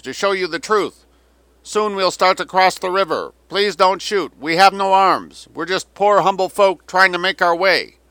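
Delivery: high, loud declaimed propaganda plea; roaring loudspeaker appeal. A narrator's reading. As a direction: project grandly across a river, phrase by phrase. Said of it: "The roaring voice rolled across the river".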